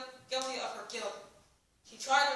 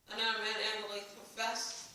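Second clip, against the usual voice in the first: ordinary delivery, nothing marked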